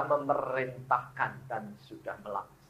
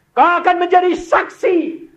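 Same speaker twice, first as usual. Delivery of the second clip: high, loud shout of a preacher; fervent preaching shout; 13 dB louder than usual. Acted. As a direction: cry it out to the whole church. Preacher exhorting the women.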